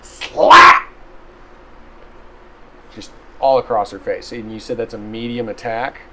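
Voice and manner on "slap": slap sound effect. Acted.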